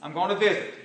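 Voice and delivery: loud, high, ringing pulpit voice; fiery preaching. Acted. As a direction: preach it out to the whole congregation. Preacher in full flow.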